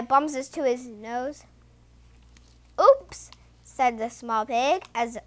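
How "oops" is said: quick high, loud oops; storybook startled yelp; startled exclamation. A narrator's reading. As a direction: pop it out high in surprise. Pig startled by a jumping frog.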